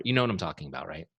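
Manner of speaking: ordinary talk, nothing marked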